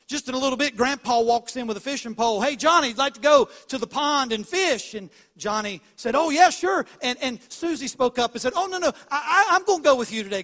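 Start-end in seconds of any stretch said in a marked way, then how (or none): 2.4-4.9 raised, louder storytelling grandpa; hearty invitation. Retold or imitated. Grandpa invites Johnny to the pond, loud and hearty. 6.2-6.8 high, loud, excited yes; eager acceptance. Retold or imitated. Johnny answers grandpa's fishing invitation, loud and eager. 8.5-10.4 high, loud butting-in; insistent objection. Retold or imitated. Susie speaks up to take Johnny's place, loud and insistent.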